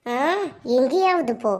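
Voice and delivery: normal talking voice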